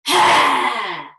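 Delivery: big loud roaring exhale; lion breath roar. Shown as a demonstration. Teacher shows the lion's breath roar to the class. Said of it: "I'm gonna take one deep breath".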